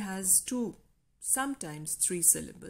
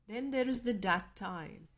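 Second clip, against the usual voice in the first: ordinary talk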